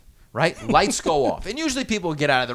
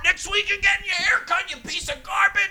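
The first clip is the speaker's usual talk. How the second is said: high, strained mock bark; drill instructor's yell; 14 semitones higher than usual. Instructors yelling at recruits about haircuts, high mock yell. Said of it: "They yelled at us".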